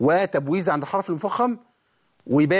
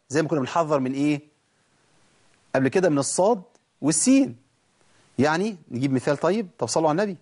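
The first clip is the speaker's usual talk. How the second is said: normal talking voice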